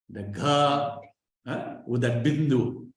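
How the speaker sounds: usual speaking voice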